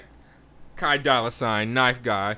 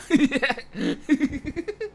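laughing; 13 semitones higher than usual. Real, not acted.

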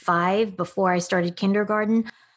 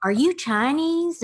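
high, drawn-out questioning; imitated nosy question, pitched way up; puzzled classmate; 9 semitones higher than usual. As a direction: mimic a baffled kid asking. Classmates puzzling over her background.

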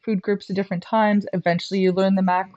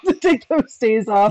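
laughing; 4 semitones higher than usual. Real, not acted. Laughing through own remark.

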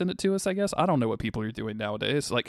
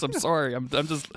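ordinary talk

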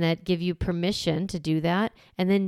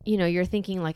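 normal talking voice

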